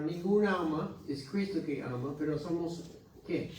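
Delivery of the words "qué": ordinary talk, nothing marked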